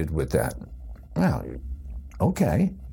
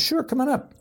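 high, bright invitation from the stage host; cheery showman inviting a volunteer up; host's voice. Retold or imitated. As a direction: call him up like a cheery showman. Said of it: "this guy says".

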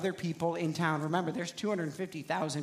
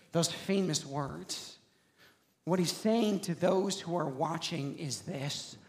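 ordinary talk

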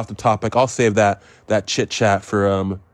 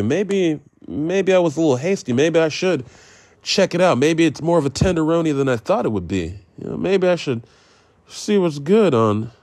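higher, musing, persuading himself; talked-himself-into-it voice; 5 semitones higher than usual. Retold or imitated. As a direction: talk yourself round, pitch up. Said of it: "I said".

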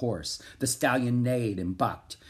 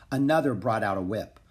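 usual speaking voice

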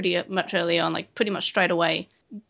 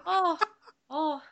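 put-on crap Irish accent; 7 semitones higher than usual. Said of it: "put on our crap Irish accents".

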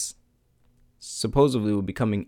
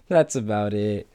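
ordinary talk

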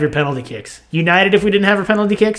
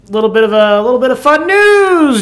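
news ticker voice; 8 semitones higher, 4 dB louder than usual. Pretend. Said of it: "That was my news ticker".

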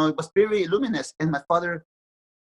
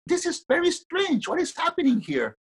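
puzzled father; 13 semitones higher than usual. Retold or imitated. Father puzzled in retold dream.

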